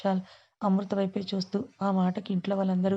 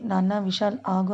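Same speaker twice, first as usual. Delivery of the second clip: ordinary delivery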